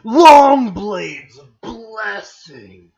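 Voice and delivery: loud, drawn-out proclamation; hammed-up heroic reveal; dramatic announcer. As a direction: announce it like a legendary weapon. Reads weapon names dramatically.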